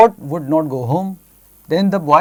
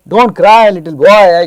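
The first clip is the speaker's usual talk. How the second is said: louder, higher plea from a lightly voiced rabbit; storybook consoling; 3 semitones higher, 8 dB louder, much slower than usual. Rabbit tells crying boy not to cry, in emphatic storybook consoling. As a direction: call out the comfort emphatically.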